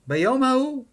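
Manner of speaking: "bayom hahu" punched out high and loud; stress on bayom hahu. Shown as a demonstration. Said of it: "what's this emphasis on that day".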